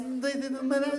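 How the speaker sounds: put-on high, unintelligible Portugal-accent mumble; mock European Portuguese. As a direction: run it together like garbled European Portuguese. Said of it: "Eles vão falando assim".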